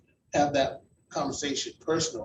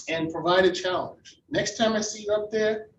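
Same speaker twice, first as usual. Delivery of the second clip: ordinary delivery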